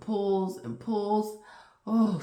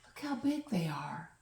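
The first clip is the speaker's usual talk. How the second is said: soft, hushed whisper; awed whisper watching a giant dragonfly; 9 dB quieter than usual. Acted. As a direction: breathe it so the bug won't hear.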